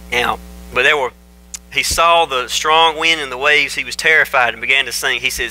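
ordinary talk

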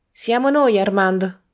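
loud, high call from outside the door; a woman shouting her arrival at the door. A narrator's reading. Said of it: "gridò una voce".

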